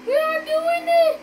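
very high, loud thrilled squeal; pitch-high excited scream, slowed on oh my God. Real, not acted. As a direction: shriek with joy, almost winning. About to win the race.

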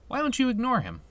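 high-pitched questioning suggestion; advising game doctor. Acted. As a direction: offer advice in a lifted voice. Voices doctor questioning Squall.

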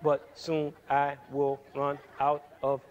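slow, low, unbroken monotone run-on; no pauses. Shown as a demonstration. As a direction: drone on without any rest or accent. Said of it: "what if we spoke like".

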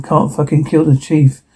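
ordinary talk, nothing marked

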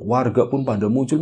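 ordinary delivery, nothing marked

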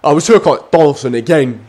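ordinary talk, nothing marked

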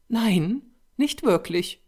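high-pitched reply from the model; female character voice. A narrator's reading. Model conceding to the angry painter, high-voiced.

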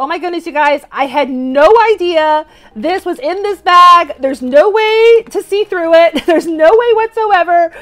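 real excitement, stressed words stretched out; really excited. Real, not acted. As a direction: gush loudly in thrilled disbelief. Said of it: "I got really, really excited".

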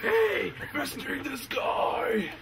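high, slow hammed-up shout; mock metal yell. Joking with bandmates, high hammed-up metal shout. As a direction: belt it like a metal frontman.

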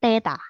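teacher modelling "teta" clearly for the class; correct reading. Shown as a demonstration. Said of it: "Oke, dibacanya".

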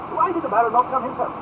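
high, loud lordly demand; demanding duke. Acted. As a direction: press him like an impatient duke. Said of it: "demanded Buckingham".

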